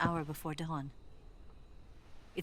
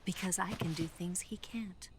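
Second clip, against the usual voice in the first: whisper. Acted. Secret meeting, hushed reply.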